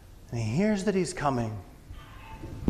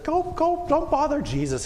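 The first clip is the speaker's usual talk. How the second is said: high, brisk shooing off; shooing disciples; 4 dB louder than usual. Acted. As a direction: disciples waving the children away.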